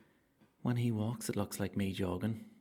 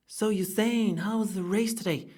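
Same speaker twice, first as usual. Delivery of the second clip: much higher, loud squeaky interviewer voice; mock interviewer; 12 semitones higher, 10 dB louder than usual. Comic interviewer chasing running Bolt, squeaky high voice. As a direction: chase him with the question in a shrill squeak. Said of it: "somebody interviews him".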